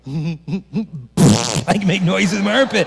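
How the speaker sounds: put-on teen boy bragging about armpit noises; dopey teen boy. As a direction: drag it out like a clueless kid. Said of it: "be like".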